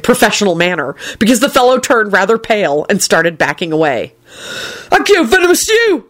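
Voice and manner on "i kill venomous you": high livid yell. A narrator's reading. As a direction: spit it out in fury. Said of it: "Neville, who was livid".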